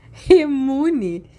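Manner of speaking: blurted out mid laugh; snorting with laughter. Acted. Sirius, breathless from laughing, snorts the silly nickname. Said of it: "mal conseguia respirar de tanto rir".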